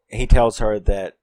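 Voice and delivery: ordinary talk, nothing marked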